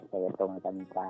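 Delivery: ordinary delivery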